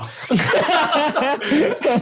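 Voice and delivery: loud, high burst of real laughter. Real, not acted. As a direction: crack up at your own story. Laughing at his own story.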